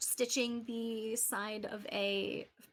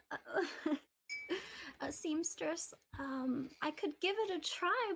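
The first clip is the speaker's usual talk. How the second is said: higher, hesitant novice; nervous, self-deprecating girl; shy Eliza voice; 5 semitones higher than usual. Acted. GM voicing Eliza.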